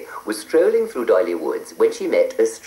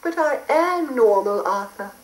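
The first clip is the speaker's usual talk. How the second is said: high fussy fairy; indignant little fairy. Acted. Fairy protests.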